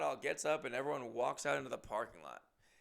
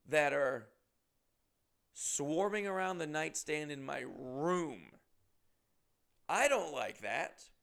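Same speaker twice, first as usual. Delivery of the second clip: normal talking voice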